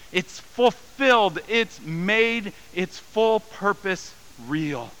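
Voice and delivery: slow emphatic preaching. Acted. Pastor explaining fulfillment, slow emphatic preaching. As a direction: drag it out for weight.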